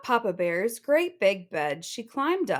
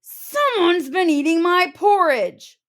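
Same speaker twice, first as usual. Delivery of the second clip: loud, higher roar; Papa Bear roaring about his porridge; 8 semitones higher, 9 dB louder than usual. Acted. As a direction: roar it in outrage. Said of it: "roared Papa Bear".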